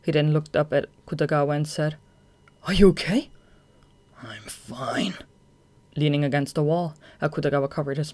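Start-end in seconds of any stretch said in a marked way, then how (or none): 2.6-3.3 put-on higher, worried young man; concerned. Tanizaki checks on him. 4.2-5.1 lower, hushed, tired; weak whisper. Acted. Akutagawa hurt, coughing.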